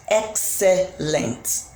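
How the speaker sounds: "excellent" very slow, each syllable drawn out, falling; slow syllable demo. Shown as a demonstration. Teacher counting the syllables of "excellent" for students, very slowly. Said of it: "A word like".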